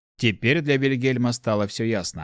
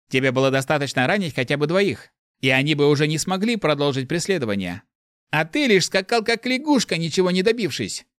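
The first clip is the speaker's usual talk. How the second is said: higher, scolding inner voice; indignant voice in head; 6 semitones higher than usual. A narrator's reading. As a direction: chide him from inside his head. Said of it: "негодовал голос в голове Марта".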